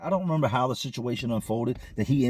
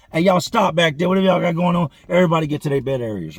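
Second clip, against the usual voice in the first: loud, higher command, speeding up at the end; officer yelling; 6 semitones higher, 7 dB louder than usual. Retold or imitated. Officer yelling at inmates to break up the fight, loud command. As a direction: bark the order over the whole dorm.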